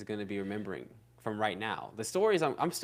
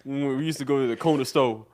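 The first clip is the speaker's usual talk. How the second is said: put-on old uncle voice; 3 semitones higher, 12 dB louder, faster than usual. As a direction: boom it out like Unc at the family cookout. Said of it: "Bro, you sound like Unc when".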